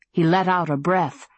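ordinary delivery

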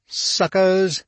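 high-pitched jeering yell; yelling taunt. A narrator's reading. Rider to kids on the corner, yelling. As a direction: yell it out at someone across the street. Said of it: "he yelled at some kids".

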